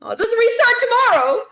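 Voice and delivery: sing-song high mockery of your own excuse; mock self-excusing voice. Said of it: "I'd be like".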